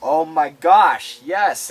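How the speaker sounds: slow, loud, drawn-out delight; delighted exclamation. Real, not acted. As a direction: gush with delight. Delighted agreement.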